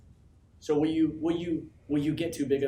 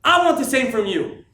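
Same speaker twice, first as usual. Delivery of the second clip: louder, higher and deliberately slow; slow firm shout; 9 semitones higher, 11 dB louder than usual. Real, not acted. Speaker to his team, slowly insisting out loud. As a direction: lean on each word. Repeats demand slower.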